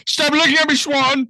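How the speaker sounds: high, loud squealed movie quote; mock shrieking. Mock outburst quoting a film line.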